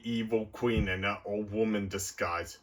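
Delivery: ordinary talk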